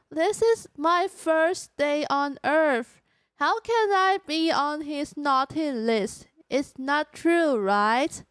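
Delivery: slow, deliberate English complaint; careful, halting protest in English; aggrieved alien newcomer. Pretend. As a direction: plead your case slowly, word by word.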